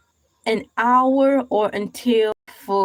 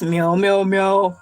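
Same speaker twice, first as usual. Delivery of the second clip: mock cat sounds, lower voice; playful meowing; 5 semitones lower than usual. Animal noises joke.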